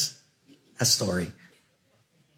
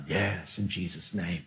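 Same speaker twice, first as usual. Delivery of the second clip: low, soft, lazy stoner; laid-back stoner voice; 8 semitones lower, 9 dB quieter than usual. Retold or imitated. Retelling the stoner preacher, low and lazy. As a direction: drop it low and easy like a mellow stoner.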